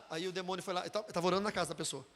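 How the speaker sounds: ordinary delivery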